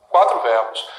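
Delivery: ordinary delivery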